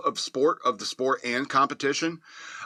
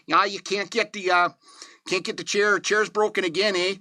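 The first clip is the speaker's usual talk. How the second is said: put-on higher, Canadian-sounding accent with a closing eh; 7 semitones higher than usual. Reviewer imitating the heroine's Canadian accent, pitched higher. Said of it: "why she sounds so Canadian".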